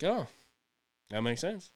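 usual speaking voice

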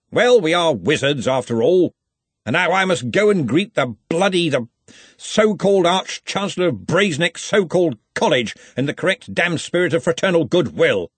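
high, loud, grudging grumble; irritable bluster at a rival; blustering senior wizard. A narrator's reading. Ridcully must go and greet his rival, blustering.

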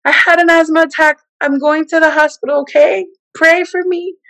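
high, light and casual; chirpy voice breaking bad news to mom; breezy forced cheer. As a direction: play down the emergency.

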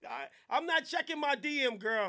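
loud, high protest; indignant yell; shouting denial. Real, not acted. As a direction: shout it out in defence. Denies it, shouting.

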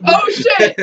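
high, mock horrified gasp; shocked waking. Waking chained in a bed, a high mock horrified reaction. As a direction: react like you've just woken up chained. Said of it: "You wake up chained to a bed like".

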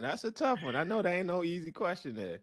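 high-pitched, amused concession; animated, high-pitched commiserating; playful sympathy. Real, not acted. Playfully sympathizes over tough question.

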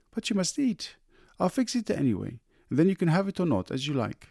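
high, hurried wife insisting; insistent wife. A narrator's reading. Wife anxiously insisting husband must eat, high.

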